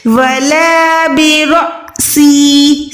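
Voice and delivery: slow chant, बिलिह्यती repeated; slow recitation. Shown as a demonstration. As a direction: recite slowly with tajweed. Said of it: "same तरीके से". (धीमी तिलावत)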